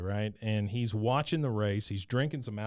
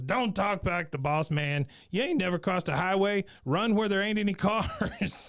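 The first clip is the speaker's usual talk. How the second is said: hillbilly boss voice; 7 semitones higher than usual. Retold or imitated. Wichert bossing Bobby.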